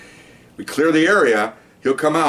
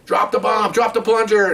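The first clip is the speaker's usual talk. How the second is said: imitating yelled commands; 10 semitones higher than usual. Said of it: "the other side's yelling commands".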